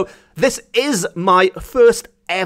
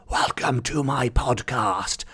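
a hint of a whispery horror host, slow and much softer; mock horror host; 5 semitones lower, 8 dB quieter, slower than usual. Podcaster mocking horror hosts, mock whispery welcome. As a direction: tease the spooky host cliché, softly. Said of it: "all mysterious and whispery".

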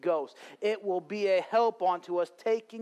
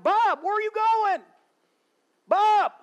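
high, loud call after a leaving friend; 9 dB louder than usual. Retold or imitated. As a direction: shout his name after him. Cousin calling after friend.

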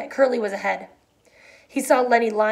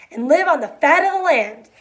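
joyful; 6 semitones higher, 6 dB louder than usual. A narrator's reading. Lenny joyful about the dream.

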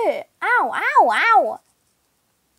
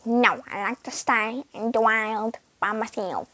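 lower, odd voice for the pet cat; weird cat voice; 5 semitones lower, 5 dB quieter than usual. Pretend. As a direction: voice the stubborn cat strangely. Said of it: "Tofu has, like, a weird voice".

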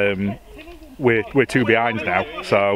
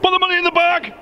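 high, barked robber's demand; bank robber; faster than usual. Pretend. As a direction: yell it like a hold-up. Joking about robbing a post office.

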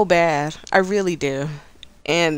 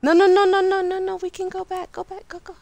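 panicked retreat; 9 semitones higher than usual. Real, not acted. Player urging a retreat mid-fight, high panicky fading voice. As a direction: start high and urgent, trail to a hush.

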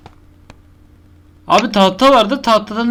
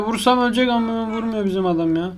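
ordinary talk

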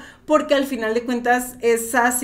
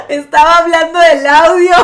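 loud laughing protest, voice high and bursting; 6 semitones higher, 8 dB louder than usual. Real, not acted. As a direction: laugh it off at full volume, teasing. Host laughing at dirty reading. (riendo a carcajadas, protestando juguetona)

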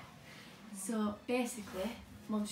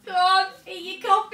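high, loud taunting push; loud teasing urge; 8 semitones higher, 13 dB louder than usual. Real, not acted. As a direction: egg her on loudly. Urging sister to eat.

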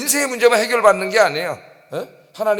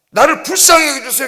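loud, high imitated cry for mercy; acted plea; 6 semitones higher, 7 dB louder than usual. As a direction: beg loudly like the blind man. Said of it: "기도했는데".

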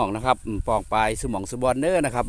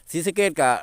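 Sisaket accent; 5 semitones higher, 4 dB louder than usual. Shown as a demonstration. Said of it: "กล้วยกะสำเนียง".

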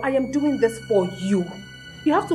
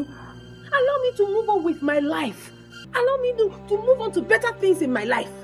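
exasperated demand; 7 semitones higher than usual. Acted. Demands to be let go.

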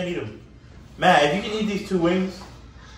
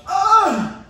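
yelp; 9 dB louder than usual. Real, not acted. Sudden yelp from spicy wings.

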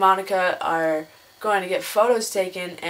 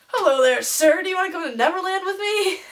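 high, singsong creepy Peter Pan; mock creepy invitation; 10 semitones higher than usual. Peter Pan inviting an adult to Neverland, mock creepy. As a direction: climb higher into an over-friendly creepy invite. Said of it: "it'd be really super creepy".

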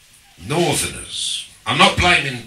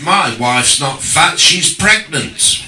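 shouted retort; 5 semitones higher, 7 dB louder, slower than usual. Retold or imitated. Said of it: "he leaned out of the window and he said".

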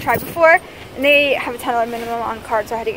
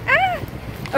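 squeal. Real, not acted. Squeal on getting the drink.

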